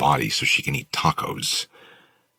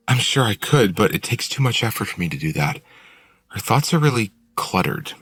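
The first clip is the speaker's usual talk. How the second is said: put-on higher young woman voice; Willow; 8 semitones higher, 3 dB louder than usual. A narrator's reading. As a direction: explain lightly in a girl's voice. Willow explains, held character voice.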